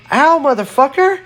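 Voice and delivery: very high, loud cry of pain; pained yell. A narrator's reading. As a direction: yelp it out as your hair is pulled. Hair yanked by the bear.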